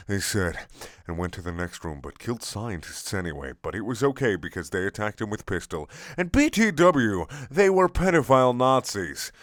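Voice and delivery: normal talking voice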